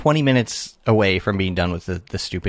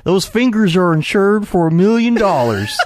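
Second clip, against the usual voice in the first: loud, high-pitched imitation of a trucker's accent; exaggerated put-on accent, high and booming; Mickey's accent; 11 semitones higher, 5 dB louder than usual. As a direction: belt it out in a hammed-up trucker accent. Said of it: "he had this accent".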